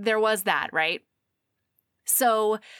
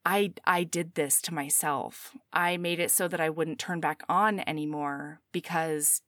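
low, softer voice for the robot; resigned confession; 6 semitones lower, 5 dB quieter than usual. Retold or imitated. Robot tells his love he gave himself up, resigned. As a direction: confess it quietly, slowing toward the end.

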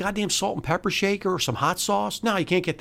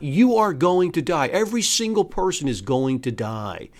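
ordinary talk, nothing marked

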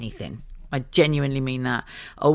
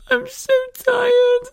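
much higher, slow whimpering whine; whiny tired wail; much slower than usual. Retold or imitated. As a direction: wail it like an exhausted child. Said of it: "I went".

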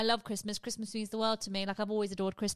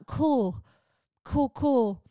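loud, higher, flustered cool; awkward cool cool cool; 4 semitones higher, 6 dB louder, much slower than usual. Retold or imitated. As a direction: cover the shock with a quick cool. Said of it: "I was like".

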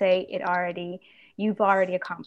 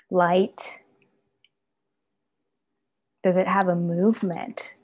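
guided meditation; 3 dB louder than usual. Acted. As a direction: gently guide them into the body. Leads a body-scan meditation.

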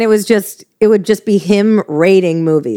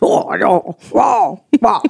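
mock groans of exertion; imitated huffing and puffing; slower than usual. Wife mimics husband out getting the gas, huffing and puffing. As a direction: huff the words, mimicking someone struggling. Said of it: "like, huff and puff".

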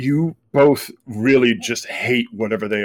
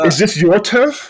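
put-on higher, curious boy voice; a higher, naive schoolboy question; 8 semitones higher than usual. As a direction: ask it like a puzzled kid.

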